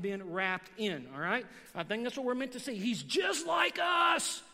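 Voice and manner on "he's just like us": high, slow preacher's shout; raised-voice sermon climax; emphatic shout. Real, not acted. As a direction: proclaim it, stretching the words out.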